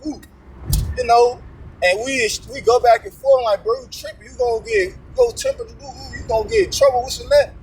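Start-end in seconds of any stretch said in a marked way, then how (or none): 3.6-7.5 retold pitched-up rebuke. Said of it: "I just start rebuking his ass".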